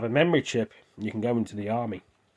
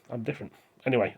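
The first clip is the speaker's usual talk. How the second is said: usual speaking voice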